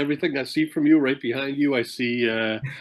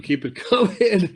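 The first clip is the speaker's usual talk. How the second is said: laughing; 9 semitones higher than usual. Retold or imitated. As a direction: repeat it high with a laugh. Repeats order, laughing.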